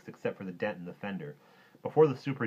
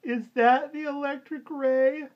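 put-on very high, loud, slow child's wail; drawn-out high-pitched cry; 10 dB louder, slower than usual. A narrator's reading. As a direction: squeal it like a frightened small boy. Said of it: "little Louis cried".

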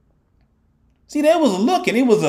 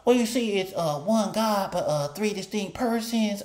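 mock patient explainer; 4 semitones higher, 8 dB quieter than usual. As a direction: play the smug explainer, hushed. Mocking the Trinitarian explanation.

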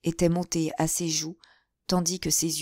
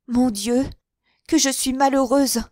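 higher, louder anguished cry; sobbing lament; 7 semitones higher, 6 dB louder than usual. A narrator's reading. Henriette alone after Mouret's choice, sobbing in despair. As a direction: wail it through tears. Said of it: "au milieu de ses sanglots".

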